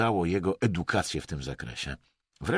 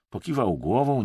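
ordinary delivery, nothing marked

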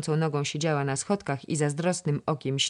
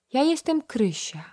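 put-on much higher child's voice; bold little girl; 10 semitones higher than usual. A narrator's reading. Toddler telling the princess her name, high and bold. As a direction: pipe it proudly.

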